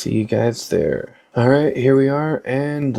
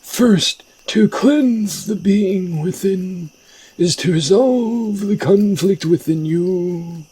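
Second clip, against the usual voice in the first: high, drawn-out mystical master; slow, ominous, high-pitched mentor; shaman teacher character voice; 9 semitones higher, slower than usual. Acted. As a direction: warn the pupil in a high, theatrical voice. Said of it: "I like that voice better for this character".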